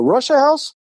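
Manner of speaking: high-pitched expectant question; eager guess. Retold or imitated. Teller answers the ringing phone, high expectant query.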